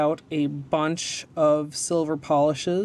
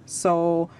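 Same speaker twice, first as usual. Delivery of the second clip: usual speaking voice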